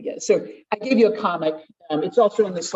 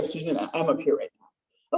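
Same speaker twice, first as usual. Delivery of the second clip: calm admission; 4 semitones lower, 5 dB quieter than usual. Shown as a demonstration. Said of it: "I could go".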